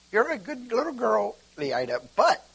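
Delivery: high-pitched teasing tone, fast at the end; mock teasing voice. Teasing the girl Leida, high and playful. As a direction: tease her in a high playful voice. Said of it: "You're just as selfish as everybody else".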